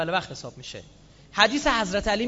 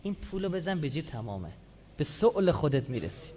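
devil tempting; 4 semitones lower, 7 dB quieter than usual. Acted. میگه.

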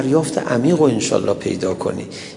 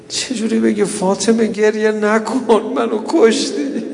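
raised, slow lamenting cry; impassioned mourning recital; 8 semitones higher, 3 dB louder, slower than usual. Acted. Preacher recounts the weeping daughter to mourners, impassioned lament. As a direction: draw out the grief at high pitch.

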